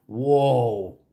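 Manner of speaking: ordinary talk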